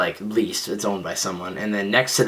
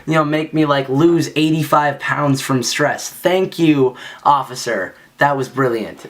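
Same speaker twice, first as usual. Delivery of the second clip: high sarcastic mock gratitude; 4 semitones higher than usual. Skater sarcastically thanking the officer for the wait. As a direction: drip sarcasm, starting high. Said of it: "I'm just being a bitch".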